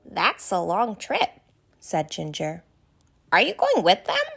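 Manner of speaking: put-on a kind, worried young giraffe asking questions; Ginger the giraffe. Ginger replies.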